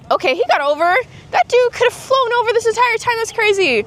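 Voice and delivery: high, loud, quick burst of amazement; amazed outburst. Real, not acted. Vlogger watching a bird get over, high amazed exclamation.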